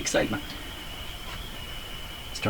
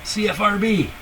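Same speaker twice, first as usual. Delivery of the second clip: excited; 10 semitones higher than usual. Real, not acted. Station found, triumphant call.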